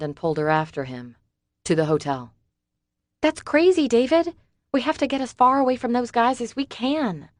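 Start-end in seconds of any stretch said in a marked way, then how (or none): 3.2-7.3 higher, louder, alarmed protest; Summer's voice. A narrator's reading. Frightened Summer objecting to David, alarmed. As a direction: push back at him in a frightened pitch.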